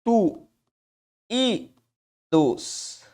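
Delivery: "tu", "i", "dos" said one at a time with pauses; split into syllables. Shown as a demonstration. Teacher to students, splitting "substituídos" syllable by syllable. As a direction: sound out each syllable on its own. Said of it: "eu tenho ti".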